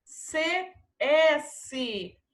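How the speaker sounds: ordinary delivery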